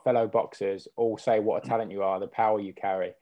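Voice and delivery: ordinary delivery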